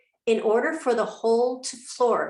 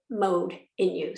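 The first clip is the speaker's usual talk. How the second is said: ordinary talk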